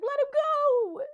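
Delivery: high, loud, drawn-out mock cry; pleading mayor's shout. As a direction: shout desperately for the child's release. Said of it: "And the mayor's like".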